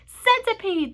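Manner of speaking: loud, high calling out; shouted call. A narrator's reading. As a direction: call a friend over excitedly. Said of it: "they shouted".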